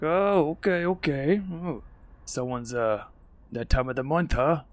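high sneering singsong backing off; mocking orc. Orc needling Grimsby, high mocking taunt. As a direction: tease him while slowing down. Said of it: "he's like".

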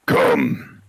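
low, loud summoning call; yell. Acted. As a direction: call him forward with one booming word. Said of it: "he just yells out".

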